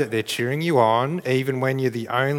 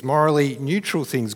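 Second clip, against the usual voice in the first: ordinary talk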